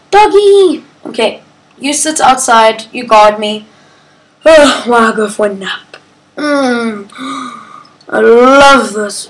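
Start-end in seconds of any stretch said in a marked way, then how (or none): 0.0-0.8 high, delighted cry of a new find; excited gasp. Real, not acted. Spotting a dog in the game, thrilled squeal. 6.4-9.3 1 contented. Real, not acted. Settling in for a nap.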